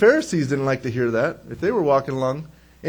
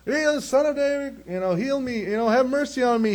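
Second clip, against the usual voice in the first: higher-pitched mock calling out; mock loudmouth; 7 semitones higher than usual. Loudmouth begging Jesus for mercy, mock calling out. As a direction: imitate a loudmouth pestering for attention. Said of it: "Here's this loudmouth".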